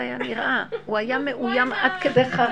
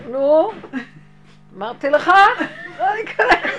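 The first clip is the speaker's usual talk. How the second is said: scolding; 7 semitones higher, 9 dB louder than usual. Retold or imitated. Gabbai scolding.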